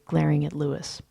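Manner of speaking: plain reading voice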